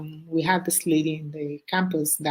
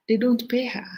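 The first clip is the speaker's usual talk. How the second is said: normal talking voice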